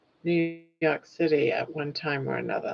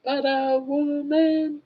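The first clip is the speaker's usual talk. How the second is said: much higher and slow, "Butter woman"; high playful call; 10 semitones higher, slower than usual. Pretend. Scene: host playfully calling the caller butter woman, high. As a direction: sing out the nickname high and slow.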